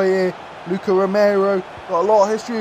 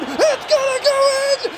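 peak excitement scream. Real, not acted. Gamer as the ball hits the net, ecstatic scream. Shot heading for goal.

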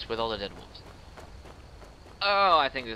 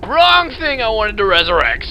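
loud, higher exasperated yell; exasperated outburst; frustrated shout; 9 semitones higher, 15 dB louder than usual. Real, not acted. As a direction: yell at your own mistake. Resurrected wrong thing.

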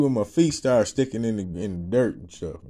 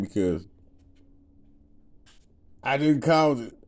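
ordinary talk

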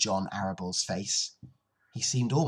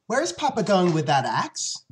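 put-on raised, lively child's question; lightly voiced curious daughter; young Fern asking; 9 semitones higher, 7 dB louder than usual. Fern asks her mother about Papa, higher and louder.

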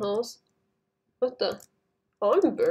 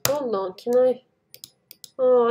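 usual speaking voice

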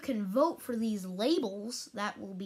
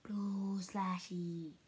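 whisper; 4 semitones lower, 7 dB quieter, much slower than usual. Real, not acted. Repeating smell, whispered.

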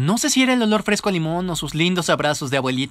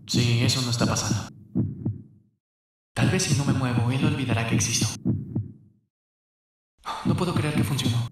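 lower, softer thinking-to-himself voice; anxious inner thoughts; 8 semitones lower, 6 dB quieter than usual. Acted. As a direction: worry quietly inside your head about the presentation. Panicked inner thoughts in class. (pensamientos nerviosos, voz más grave y suave)

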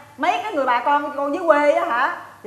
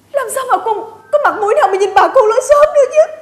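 high, loud melodramatic wail; hammed-up sobbing plea; crying; 7 semitones higher, 7 dB louder than usual. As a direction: break into a tearful lament. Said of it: "mày làm gì tự nhiên mày khóc vậy?".

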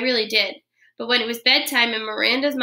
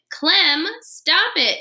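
higher, louder, firm command to the dog; firm shout; 8 semitones higher, 4 dB louder, much slower than usual. Acted. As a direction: stop the dog with a sharp order. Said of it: "she shouted in a very firm voice".

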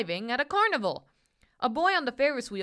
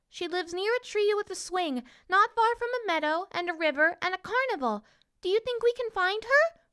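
high, hopeful doll voice; paper princess; 10 semitones higher than usual. A narrator's reading. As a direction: explain hopefully in a tiny high voice.

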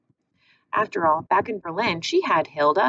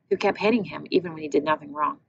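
plain reading voice

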